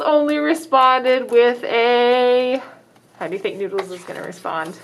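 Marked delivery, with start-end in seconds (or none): none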